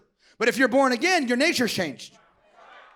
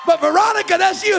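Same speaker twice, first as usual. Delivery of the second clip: higher, louder voice of a protesting ex; protesting ex-boyfriend; 7 semitones higher, 9 dB louder than usual. Acted. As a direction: act out the ex insisting, raised voice.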